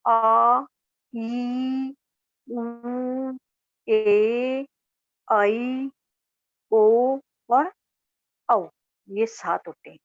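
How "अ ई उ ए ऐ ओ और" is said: each long vowel drawn out and called out one by one; long vowels demonstrated. Shown as a demonstration. Teacher demonstrating long vowels to students, drawn out. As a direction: stretch every long vowel for the class. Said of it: "ये देखिए".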